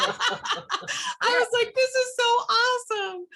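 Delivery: high, laughing excitement; thrilled. Real, not acted. Thrilled at seeing her mother's crooked teeth, laughing. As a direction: squeal with delight and laugh.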